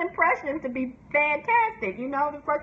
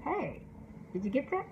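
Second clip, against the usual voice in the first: commercial guy; 8 dB quieter than usual. Retold or imitated. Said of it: "the little man ... calls the man on the phone".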